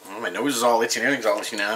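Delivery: usual speaking voice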